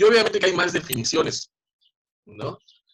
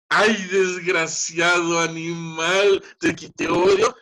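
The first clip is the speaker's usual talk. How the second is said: slow, loud, sugary pet talk wrapping an insult; sweet-talking the cat; 3 semitones higher, 5 dB louder, slower than usual. Retold or imitated. Teacher to her cat, sweet slow coo. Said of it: "le digo a mi gato".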